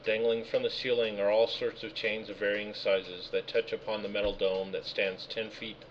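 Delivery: ordinary talk, nothing marked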